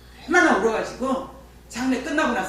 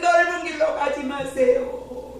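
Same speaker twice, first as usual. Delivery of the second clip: high, slow, tearful shout that fades toward the end; wailing cry; 8 semitones higher, 3 dB louder, slower than usual. Retold or imitated. Grieving daughter crying out after her father's death, wailing. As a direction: cry it out for the whole village to hear. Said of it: "막 이렇게 울었어".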